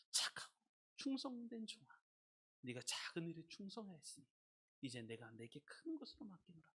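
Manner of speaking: hushed commendation. Retold or imitated. God commending the faithful servant. (나직이 칭찬하는 목소리)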